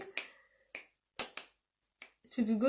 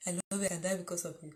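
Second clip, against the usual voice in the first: rattled-off recitation; 3 semitones lower, 6 dB quieter, much faster than usual. Retold or imitated. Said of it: "as if it is memory verse".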